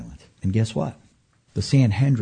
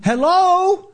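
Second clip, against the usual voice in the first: excited; 4 dB louder than usual. Real, not acted. Preacher's excited climax.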